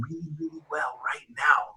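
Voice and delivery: unvoiced-sounding, intense hush on the advice; hushed urgency. Real, not acted. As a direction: drop to a near whisper.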